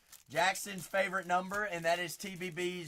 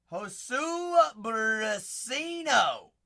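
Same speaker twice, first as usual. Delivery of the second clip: slow, stretched announcer call, louder and higher; drawn-out hype call; 6 semitones higher, 8 dB louder, much slower than usual. Acted. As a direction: savor the name like a ring announcer. Hyping a pulled prospect card.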